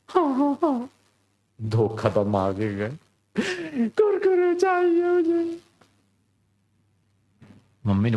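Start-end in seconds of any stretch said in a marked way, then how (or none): 0.1-0.9 put-on high eager little boy; high child voice promising mom. Chhotu promises to be good. 3.9-5.6 put-on high, slow crying little boy; boy crying to mom for snacks; crying child.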